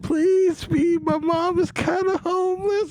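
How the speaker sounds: high, strained pleading voice; streamer trying not to laugh. Retold or imitated. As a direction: plead high-pitched, drawn out, holding back a laugh. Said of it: "trying not to laugh".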